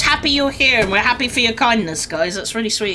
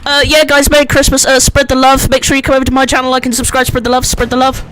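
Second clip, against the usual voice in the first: loud, fast channel promo; hammy streamer plug; 4 dB louder, faster than usual. Pretend. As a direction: shout the self-promo like an ad.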